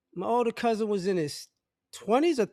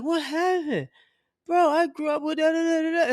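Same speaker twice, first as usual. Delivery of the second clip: mock confused friend; 7 semitones higher than usual. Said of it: "You're like".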